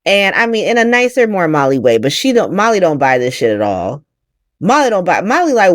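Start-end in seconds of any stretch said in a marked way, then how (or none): none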